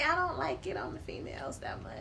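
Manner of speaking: soft unvoiced aside; whisper. Real, not acted. Confiding to her cohost about women, whispered. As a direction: whisper it as a confession.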